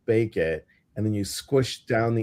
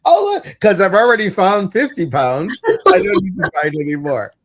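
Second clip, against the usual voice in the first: mock friend voice; 9 semitones higher, 8 dB louder than usual. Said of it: "I know you're like".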